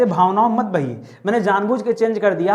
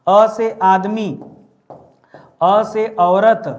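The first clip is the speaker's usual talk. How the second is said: each word drawn out very slowly for the class to memorise; slow rote drill; 4 dB louder, much slower than usual. Shown as a demonstration. Teacher drilling students to memorise words, slow and deliberate. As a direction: chant it slowly so they can learn it by heart. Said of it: "कुछ चीजें मैं आपको रटा दे रहा हूँ".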